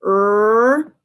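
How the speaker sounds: "Er" held long and loud as the r sound; isolated letter sound. Shown as a demonstration. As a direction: stretch out "Er" as a single phonics sound. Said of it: "let's find our sounds".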